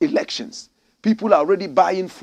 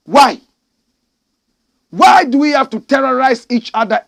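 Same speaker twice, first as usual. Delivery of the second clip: loud, high exasperated cry; much louder, indignant questioning; exasperated outburst; 7 semitones higher, 9 dB louder than usual. Real, not acted. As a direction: demand an answer at full force.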